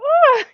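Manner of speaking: mock startled squeal; excited yelp. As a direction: let out a sudden thrilled shriek. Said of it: "I go".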